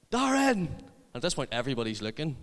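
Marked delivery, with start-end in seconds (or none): 0.1-0.7 very high, loud yell of a name; shout. Retold or imitated. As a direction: imitate the boy bellowing for attention again and louder. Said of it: "then he just shouts".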